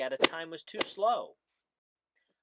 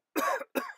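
very high cough; squeaky cough; 3 dB louder than usual. A narrator's reading. The sick Lorax coughs, very high squeaky cough. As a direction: cough up the smog. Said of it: "please pardon my cough".

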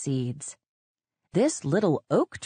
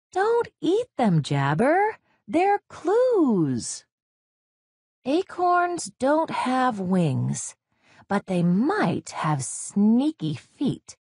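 高く明るい子ネズミの声、ゆっくり; 小さな探偵ドットとして; 少女ネズミの高い声; 8 semitones higher, slower than usual. Acted. Dot's voice, child story.

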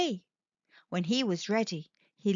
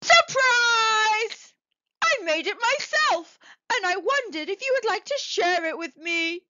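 joyful surprise; 10 semitones higher, 5 dB louder than usual. Acted. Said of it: "turned around and said".

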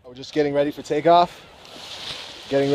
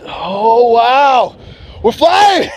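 higher, louder rush of delight; thrilled takeoff; 8 semitones higher, 5 dB louder, much slower than usual. Real, not acted. Paraglider to viewers, just airborne, thrilled and loud. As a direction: let the joy burst out as you lift off.

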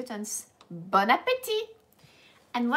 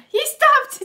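laughing, much higher and louder; 14 dB louder than usual. Real, not acted. Teacher laughs teasing that Charlie stopped. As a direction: burst out laughing at him.

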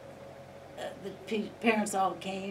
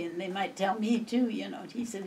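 ordinary talk, nothing marked

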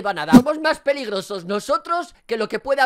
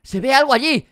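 raised, loud call building in urgency; alarmed calling out; 5 semitones higher, 9 dB louder than usual. Real, not acted. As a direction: shout out a sudden sighting. Avista algo en la oscuridad. (aviso a gritos, cada vez más fuerte)

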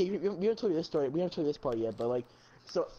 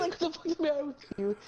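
mock scream; 8 semitones higher than usual. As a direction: mimic screaming at the cousin, high. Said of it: "screaming at Luke".

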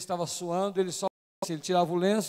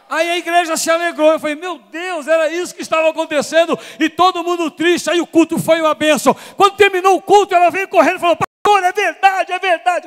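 shouted, much higher and louder, jubilant; preaching climax; 9 semitones higher, 14 dB louder than usual. Real, not acted. Preacher to congregation, jubilant high shout. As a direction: let it erupt, high and full.

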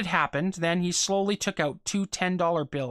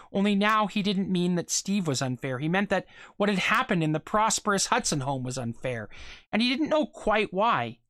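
ordinary delivery

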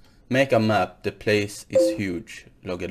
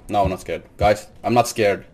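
ordinary talk

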